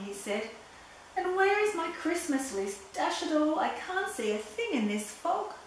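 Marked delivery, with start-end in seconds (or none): 1.2-5.5 higher-pitched, fussed Santa; cross. A narrator's reading. Santa hunting his list in the fog, cross. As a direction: grumble crossly in a raised pitch. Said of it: "Even Santa was cross".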